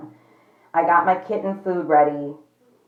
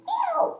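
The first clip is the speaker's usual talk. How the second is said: tiny high-pitched mewing cry; a squeaky little kitten meow, much higher; mock kitten mew; 4 dB quieter than usual. Imitating the crying kitten.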